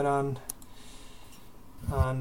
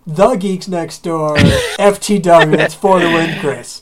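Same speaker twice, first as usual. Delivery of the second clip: slow, loud, overblown readout; mocking announcement; 9 dB louder, slower than usual. Mimicking the host giving out the email, mockingly. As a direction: spell it out with exaggerated slowness.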